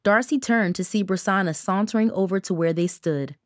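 usual speaking voice

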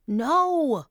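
a high, loud No; yelling. Acted. Daughter yelling at mom to stop the call, loud. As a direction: shout No to stop her. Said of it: "Angel yelled".

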